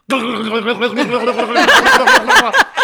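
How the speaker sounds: loud, rapid glugging noises; exaggerated chugging of a whole bottle; imitated gulping. Friend guzzles water from the bottle, loud gulping.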